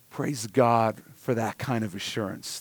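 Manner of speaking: normal talking voice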